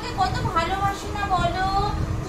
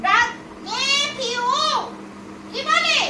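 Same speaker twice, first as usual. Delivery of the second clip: scolding teacher; 7 semitones higher than usual. Acted.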